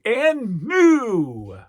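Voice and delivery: drawn-out loud ring announcer "and new"; ring announcer call. Retold or imitated. Toy reviewer mimicking the ring announcer's call. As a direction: belt it like a wrestling ring announcer.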